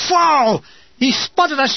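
yelled. Retold or imitated. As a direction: shout the warning as shells come. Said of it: "yelled Sherwood".

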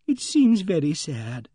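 high, slow lady's voice; saddened Emily Barton. A narrator's reading. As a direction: draw the words out sorrowfully, pitched high. Troubled lady voiced in story.

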